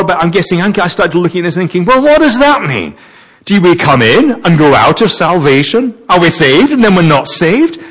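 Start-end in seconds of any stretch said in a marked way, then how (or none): 1.8-7.8 higher-pitched, re-enacted bafflement; really confused. Preacher retelling wrestling with this passage, baffled and higher-pitched. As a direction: puzzle aloud, pitch climbing with each question. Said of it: "that really confused me".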